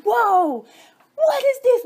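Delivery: high, loud mock amazement; wide-eyed surprised exclamation; amazed whoa. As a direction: gasp it out like a sudden discovery. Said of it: "it's like".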